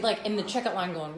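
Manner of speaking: usual speaking voice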